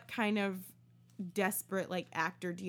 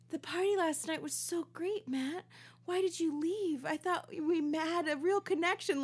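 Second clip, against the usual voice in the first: much higher, breathy-free flirty woman; 8 semitones higher than usual. Retold or imitated. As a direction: coo it like a smitten admirer. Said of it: "women calling, be like".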